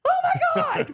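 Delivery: mock a stranger's horrified gasp; shocked gasp. Stranger in car sees her fall, high shocked cry.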